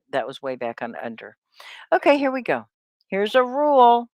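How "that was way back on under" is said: quick whispered aside, half to herself. Real, not acted. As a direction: mutter it fast under your breath. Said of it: "unvoiced whisper".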